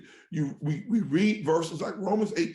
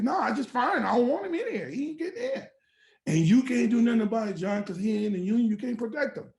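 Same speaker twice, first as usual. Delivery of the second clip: high, insistent boss shutting it down; raised pitch, emphatic mock dismissal; retold blunt refusal; 8 semitones higher, 4 dB louder, faster than usual. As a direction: imitate a boss overriding the steward. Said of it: "They're just talking like this".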